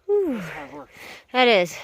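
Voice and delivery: out of breath from the climb; panting between words after steep climbing; breathless at high altitude. Real, not acted. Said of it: "It's a little hard to breathe up here".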